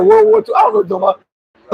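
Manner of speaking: incredulous. Real, not acted. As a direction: squeak in disbelief. Incredulous high retelling.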